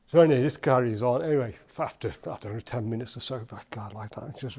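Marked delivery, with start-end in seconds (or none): none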